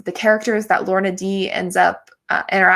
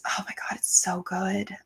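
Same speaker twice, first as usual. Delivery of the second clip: hushed, whispered gushing; awed whisper; 10 dB quieter than usual. Real, not acted. Raving to listeners about an audio drama, awed whisper. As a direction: drop to a whisper in awe.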